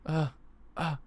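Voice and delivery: ordinary delivery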